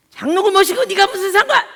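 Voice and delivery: hammed-up shouting father; father snaps at daughter about being an elder, mock yell.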